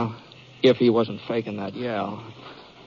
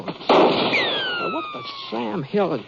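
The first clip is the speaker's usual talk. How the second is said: angry shout; much slower than usual. Acted. Shot at, shouting at the gunman.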